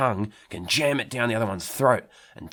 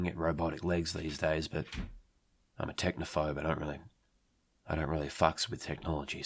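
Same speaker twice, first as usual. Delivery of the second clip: ordinary delivery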